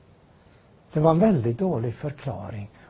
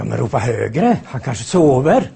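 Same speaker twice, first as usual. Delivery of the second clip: mocking taunt; 3 semitones lower, 6 dB louder than usual. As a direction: taunt the priests to shout louder. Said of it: "Elias säger". (hånfull uppmaning, långsamt och högre)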